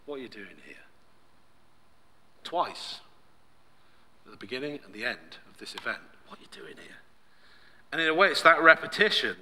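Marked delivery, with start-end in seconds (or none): 0.1-0.8 very soft, lowered question; still small voice. Acted. God questions Elijah who ran away, softly. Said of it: "The still small voice". 6.3-7.0 near-whispered question; still small voice. Acted. God repeats the question to Elijah, whispering. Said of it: "The still small voice".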